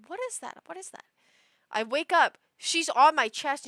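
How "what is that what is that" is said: high, hushed repeated question; startled murmur, retold. Owner woken by paws at three A M, hushed alarm. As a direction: ask it small and high, as if just woken. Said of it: "I go".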